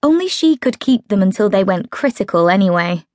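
ordinary delivery

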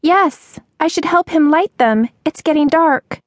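put-on high, flustered girl's voice; high-pitched, urgent young waitress; anxious waitress. A narrator's reading. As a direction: worry aloud in a high girlish voice. Agitated waitress voiced in story.

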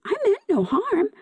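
high pleading cry; high, earnest protest; cried out. A narrator's reading. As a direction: plead innocence pitched high. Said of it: "cried Seaweed".

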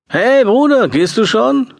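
high, loud call across room. A narrator's reading. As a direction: shout it over the party noise. Friend calling across party.

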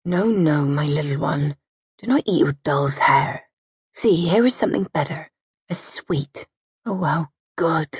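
put-on calmly distract the child, ignoring the quarrel; a hint of a stolid mother soothing her baby; heavy placid mother. A narrator's reading. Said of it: "Kite's heavy, deep voice cut in".

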